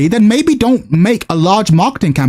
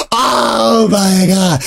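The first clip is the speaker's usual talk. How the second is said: long, drawn-out wail of disbelief; exasperated groan; much slower than usual. Real, not acted. Streamer reacting to the developers' statement, groaning in exasperation. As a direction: stretch every word into a groan.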